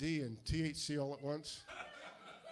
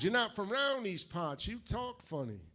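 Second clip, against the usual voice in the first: higher-pitched, drawn-out Southern drawl; slow Southerner; 6 semitones higher, slower than usual. Retold or imitated. Georgia clerk remarking on the customer's odd speech, slow Southern drawl. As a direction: mimic a Southern clerk sizing up an outsider, slowly. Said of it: "They talk slow".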